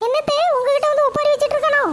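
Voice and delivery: high, sharp-voiced village mother answering her mother-in-law; mother's voice. Mother answers her mother-in-law, high and curt. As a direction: pitch it up as the boy's cranky mother. Mother mocking son to grandma.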